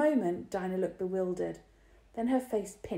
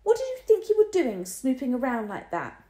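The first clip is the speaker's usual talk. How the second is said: high, loud scolding; high, loud reprimand; 6 semitones higher, 8 dB louder than usual. A narrator's reading. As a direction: tell him off. Dinah scolding.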